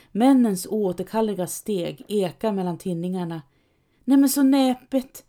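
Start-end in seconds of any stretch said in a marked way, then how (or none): 4.0-5.3 high, louder delighted call. A narrator's reading. Man with the sack calling out, delighted. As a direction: cry it out with glee. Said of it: "ropar mannen med säcken".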